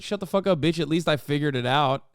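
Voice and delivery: normal talking voice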